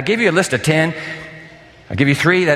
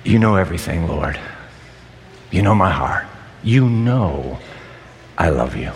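much lower, hushed confession; humble Peter; 11 semitones lower than usual. Acted. Peter answering Jesus, low and broken. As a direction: barely get the words out, ashamed. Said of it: "Peter finds his voice and he said".

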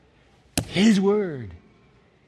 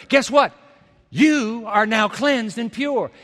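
higher, fervent preacher voice; fervent preaching; 4 semitones higher than usual. Acted. Preacher proclaims God's verdict to the congregation, fervent. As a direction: proclaim it to the crowd.

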